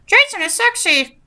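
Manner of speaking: high squawky bird mimic; parakeet chatter. Shown as a demonstration. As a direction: chirp it like a parakeet. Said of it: "That's not a very good par-, parakeet voice".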